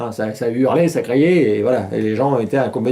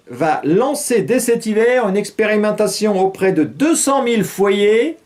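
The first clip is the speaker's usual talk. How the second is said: news read; 8 semitones higher than usual. Acted. Reads news item.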